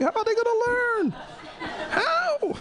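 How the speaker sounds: very high, exasperated wail; indignant protest. Retold or imitated. Teacher protesting to principal about house points, high indignant wail. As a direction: cry it out high in disbelief.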